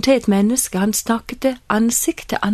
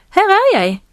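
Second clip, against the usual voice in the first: high, eager reply, slightly louder; boy answering a call; 8 semitones higher, 4 dB louder than usual. Acted. Young Samuel answers the one he thinks called him, high boyish reply. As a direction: pipe up as a boy who hears his name.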